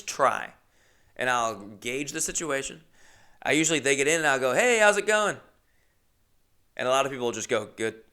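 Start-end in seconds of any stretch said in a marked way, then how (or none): 4.5-5.4 high, louder, friendly hello; acted cheery hello; chirpy greeting. 7.7-8.0 short, softer answer; uninterested passenger's mumbled reply; curt reply. Retold or imitated.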